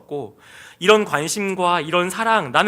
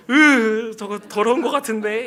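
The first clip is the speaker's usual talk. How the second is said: mock grossed-out, higher and louder at the start; disgusted; 4 semitones higher, 4 dB louder than usual. Retelling his thoughts on dirty hands in church, disgusted. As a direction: recoil with a loud "ugh". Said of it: "이런 마음이에요".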